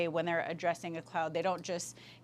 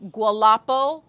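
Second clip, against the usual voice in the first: gualapo called out loud with a rise; emphatic respectful call to a group; emphatic greeting; 13 dB louder than usual. Shown as a demonstration. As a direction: call gualapo out to the whole room. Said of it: "when you're addressing a group".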